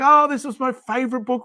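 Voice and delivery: high, excited self-quote; delighted rediscovery. Retold or imitated. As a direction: relive finding a beloved old book. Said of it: "I'm like".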